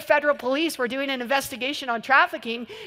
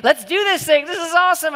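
loud, higher rally cry; pumped-up cheer; 4 semitones higher, 6 dB louder than usual. Retold or imitated. Prayer group hyping each other up, loud excited cheer. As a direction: fire up the team like a pep rally. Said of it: "and we're just like".

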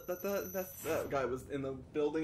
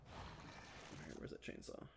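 whispering; 20 dB quieter, slower than usual. Real, not acted. Unvoiced muttering.

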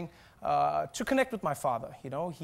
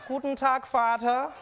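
raised-pitch German reply to dad; acted German greeting; 6 semitones higher, 4 dB louder, slower than usual. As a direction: greet father in put-on German. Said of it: "And I'll be like".

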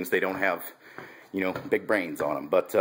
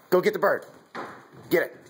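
calling dog; 9 semitones higher than usual. Real, not acted. Sending puppy to fetch.

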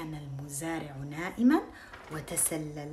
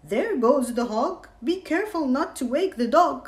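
thief voice; 7 semitones higher, 9 dB louder than usual. Acted. Thief speaks in story.

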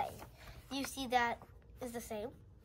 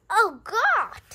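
sudden loud high exclamation; startled; 8 semitones higher, 13 dB louder than usual. Real, not acted. Girl startled by the skunk picture, loud cry. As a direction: jump at it, real fright.

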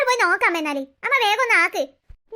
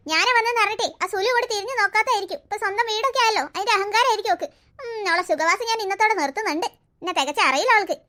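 spiteful grandmother. Acted. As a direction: mutter threats like a jealous mother-in-law. Grandmother plotting against daughter-in-law.

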